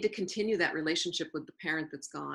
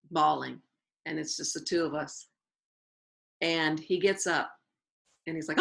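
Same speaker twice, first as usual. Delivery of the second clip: ordinary talk, nothing marked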